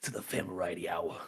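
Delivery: low, gravelly-free hard-boiled radio host; noir detective. Acted. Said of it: "a detective noir voice".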